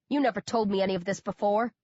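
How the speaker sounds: ordinary delivery, nothing marked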